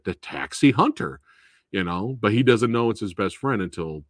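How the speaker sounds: ordinary talk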